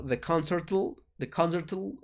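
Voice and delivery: "the concertul" with a tacked-on L sound; awkward contraction. Shown as a demonstration. Teacher demonstrating a strange-sounding contraction, mock awkward. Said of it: "Aquí sería raro decirlo".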